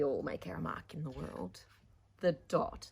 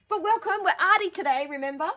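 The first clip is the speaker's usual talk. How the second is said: higher, louder cheery greeting; excited welcome; 13 semitones higher, 12 dB louder than usual. Real, not acted. Greeting children to art session.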